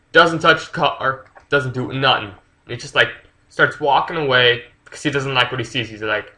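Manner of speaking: ordinary talk, nothing marked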